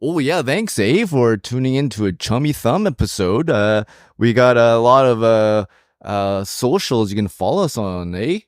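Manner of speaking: lilting, hammed-up Canadian; Canadian accent. Co-host thanking listeners at the end of the show, hammed-up Canadian accent. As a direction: play the folksy stock Canadian, badly faked. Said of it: "in your best Canadian accent".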